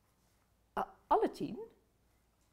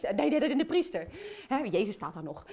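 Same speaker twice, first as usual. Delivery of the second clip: ordinary talk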